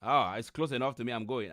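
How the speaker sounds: usual speaking voice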